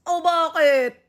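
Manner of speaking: ordinary delivery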